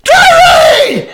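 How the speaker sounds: high, loud laughing cry for help; panicked yell. Retold or imitated. New stepdad calling his wife from the bathroom, high laughing yell. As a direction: shriek for your wife in mock panic.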